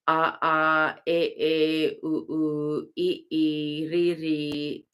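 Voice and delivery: each vowel said twice, a ah, eh eh; vowel recitation. Shown as a demonstration. Teacher sounding out the Tibetan vowels. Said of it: "they also go through the vowels".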